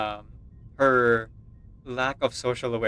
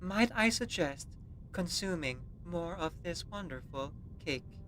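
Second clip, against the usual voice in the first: put-on friendly android with a German accent; measured, softer robot delivery in a German accent; 4 semitones higher, 7 dB quieter than usual. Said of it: "A German robot android, but friendly".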